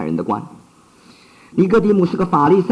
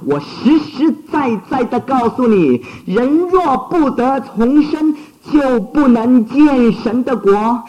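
raised, urgent sermon voice; fervent preaching; 8 semitones higher than usual. Acted. Preacher urging his flock to be reborn, fervent and raised. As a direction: plead with the hearer to be reborn.

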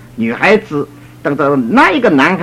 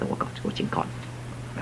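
warning whisper; 19 dB quieter, much faster than usual. Retold or imitated. Pastor warns quarrelling couple.

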